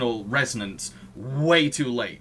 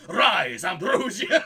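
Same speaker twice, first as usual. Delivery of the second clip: higher, hammed-up villain summons, laughing through it; a laughing send-up of a villain's grand summons; mock villain cry; 6 semitones higher, slower than usual. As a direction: call it out like a cartoon villain, half laughing. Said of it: "the villain was like".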